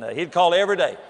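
usual speaking voice

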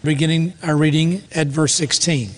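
usual speaking voice

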